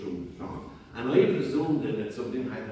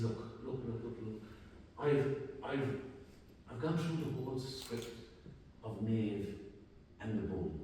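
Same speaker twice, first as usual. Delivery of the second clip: hushed agitation; 8 dB quieter than usual. Retold or imitated. Agitated whispered exchange by graveside.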